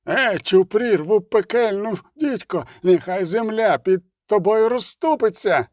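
high, slow, drawn-out curse; shouted curse. A narrator's reading. Priest curses the barber with an amulet, shouting. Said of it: "закричав жрець, вимахуючи".

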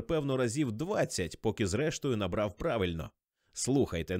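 ordinary delivery, nothing marked

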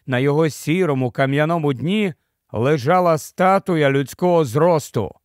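plain reading voice

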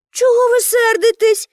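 high-pitched reproachful question. A narrator's reading. Pippi to the angry policemen, reproachful. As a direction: chide them in a high girlish voice. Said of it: "докірливо спитала Пепі".

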